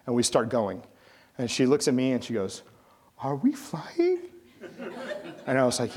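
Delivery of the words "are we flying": timid frightened question. Retold or imitated. Scared passenger at liftoff.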